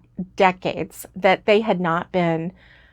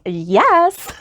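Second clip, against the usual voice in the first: high, loud, eager yes; 6 semitones higher, 5 dB louder than usual. Retold or imitated. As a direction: jump at the offer. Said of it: "I would be going".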